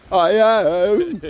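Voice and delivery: high, drawn-out little mouse voice; squeaky, effortful attempt at conviction; trying-hard mouse. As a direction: imitate a timid mum straining to say it. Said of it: "it's still a mouse speaking back".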